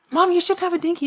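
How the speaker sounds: put-on high, earnest kid voice; imitated worried child. Confused child objects to mom, high imitated kid voice. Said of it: "And I'm like".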